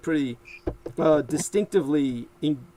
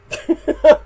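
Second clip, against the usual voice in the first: high laugh; 12 semitones higher, 11 dB louder than usual. Real, not acted. Laughs at own joke.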